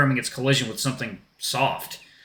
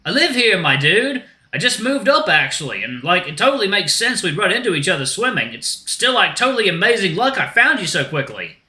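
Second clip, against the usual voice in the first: high-pitched, easygoing dude voice; chatty shark dude; 8 semitones higher, 4 dB louder than usual. Acted. Shark explains to Axel how they met, high dude voice. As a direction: explain it like a stoked surfer.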